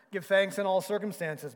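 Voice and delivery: Betsy firmly correcting her sister; firm correction. A narrator's reading. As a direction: Give thanks in all circumstances said firmly and loud. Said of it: "Betsy corrected".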